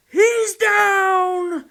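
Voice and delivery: high loud call across the field; triumphant distant holler; far-off shout. Retold or imitated. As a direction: yell it from across the woods. Father-in-law calling from thicket.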